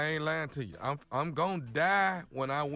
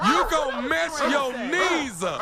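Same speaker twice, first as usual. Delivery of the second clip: laughing shout; 8 semitones higher, 5 dB louder than usual. Real, not acted. Repeats louder laughing.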